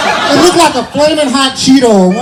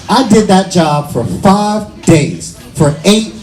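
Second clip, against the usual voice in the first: slow, weighty comic delivery; drawn-out emphasis; slower than usual. Real, not acted. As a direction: let each phrase land slowly, with gaps. Drawing out an absurd job's tedium.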